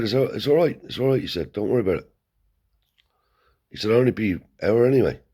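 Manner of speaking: high, hurried it's all right; anxious reassurance. Retold or imitated. Uncle Tony fobbing off the father, high hurried voice. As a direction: talk him out of coming fast.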